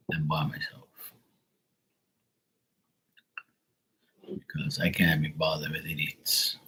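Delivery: hushed aside; whispering. Real, not acted. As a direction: whisper it to the audience. Aside about working alone.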